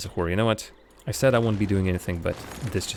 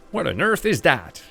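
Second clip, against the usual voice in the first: mock American accent, raised and loud; Biffa in an American accent; 7 semitones higher, 5 dB louder than usual. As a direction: throw it out like a puzzled American. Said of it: "not in a American accent I just gave him".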